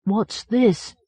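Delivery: muffled, as from inside a hole. A narrator's reading. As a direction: speak muffled from a tight space. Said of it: "his muffled voice said".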